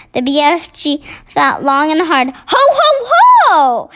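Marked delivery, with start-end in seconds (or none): 2.5-3.9 high, loud triumphant ho ho ho; booming giant's cry of sudden remembering; cried out. Acted. BFG crying out as he remembers, loud and high. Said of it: "he cried at last".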